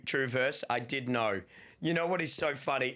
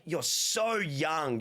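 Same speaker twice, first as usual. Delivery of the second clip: ordinary talk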